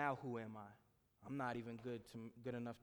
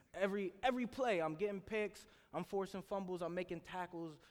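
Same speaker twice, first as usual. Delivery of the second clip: higher, louder rush of pride; excited; 5 semitones higher, 5 dB louder than usual. Real, not acted. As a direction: build the hype play by play. Excited football highlight story.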